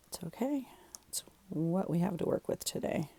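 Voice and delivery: a resigned little whisper; whispered aside. Real, not acted. Crafter whispering to her viewers about her limited supplies. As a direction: breathe it out as a private aside.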